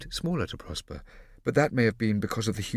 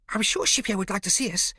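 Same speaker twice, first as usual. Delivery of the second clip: put-on high small boy voice; young boy, eager and sweet; little boy voice; 11 semitones higher, 3 dB louder than usual. A narrator's reading.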